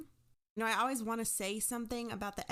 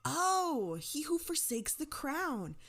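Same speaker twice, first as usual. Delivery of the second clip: excited recognition; 6 semitones higher, 4 dB louder, slower than usual. Retold or imitated. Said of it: "he went".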